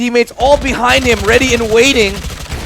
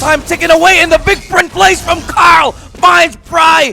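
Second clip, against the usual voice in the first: higher, louder, slow hype; excited shout; 9 semitones higher, 6 dB louder than usual. Real, not acted. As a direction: build tension into a yell. Tense final seconds call.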